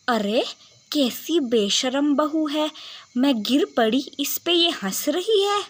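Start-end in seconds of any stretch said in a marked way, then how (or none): none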